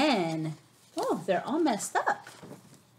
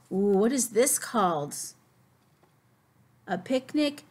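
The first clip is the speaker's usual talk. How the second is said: ordinary talk, nothing marked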